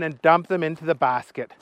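ordinary delivery

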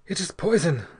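high, strained confession; strained effort. A narrator's reading. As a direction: force it out with an effort of will. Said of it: "fixed in a terrible effort of will".

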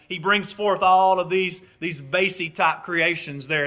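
ordinary delivery

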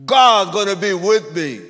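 ringing statement of faith; bold declaration. Retold or imitated. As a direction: proclaim it with full conviction. Preacher's bold reply to doubting police.

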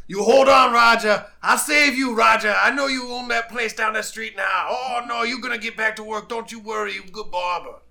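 loud, high-pitched shouting; shouted pep talk to a dying man; acted desperate rallying cry. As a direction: holler urgently to someone slipping away, easing a little toward the end. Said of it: "and I'm like".

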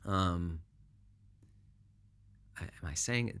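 normal talking voice

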